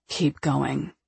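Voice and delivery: low, soft male command; hushed low order; man's low voice. A narrator's reading. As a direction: drop low and quiet for the man urging her on.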